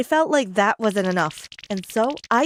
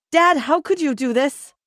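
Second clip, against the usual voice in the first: yelling; 6 semitones higher, 4 dB louder than usual. A narrator's reading. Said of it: "we yelled".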